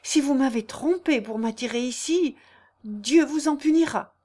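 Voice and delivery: acted fright turning to threat; sudden terror. A narrator's reading. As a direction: warn him solemnly, with rising fear. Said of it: "saisie d'une terreur subite".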